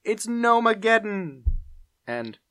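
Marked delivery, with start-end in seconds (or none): 0.0-1.4 high, loud, slow mock yell; imitated cartoon doom announcement, drawn out; Bobby Gaylor. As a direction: cry it out, stretched for drama. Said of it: "Bobby Gaylor yelling".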